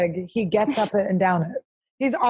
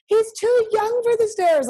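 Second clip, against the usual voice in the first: high, slow fussy scold; mock stern warning; 13 semitones higher, slower than usual. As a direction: play the overprotective dog mom. Friend quoted scolding about stairs.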